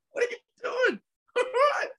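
high-pitched giggling through the words; laughing so hard the voice cracks up high; helpless laughter. Real, not acted. Scolding the cat while laughing helplessly. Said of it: "this cat's driving me nuts".

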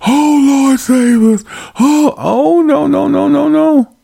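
very high-pitched panicked wail; mock hysteria. Preacher imitating people begging the Lord to save them, mock hysteria. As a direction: imitate someone crying out for rescue in panic. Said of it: "I can see the hysteria".

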